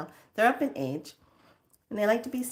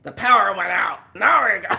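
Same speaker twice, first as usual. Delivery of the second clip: a touch louder, blunt dad; dad's voice; 7 dB louder than usual. Retold or imitated. Dad cutting in to explain the outage, mild blunt voice. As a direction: drop it flatly like dad did. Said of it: "my dad's voice comes out".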